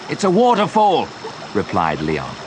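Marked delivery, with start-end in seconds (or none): 0.1-1.1 high-pitched, urgent warning; alarmed call. A narrator's reading. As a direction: call out the danger, pitch raised. Danger spotted amid shouted exchange.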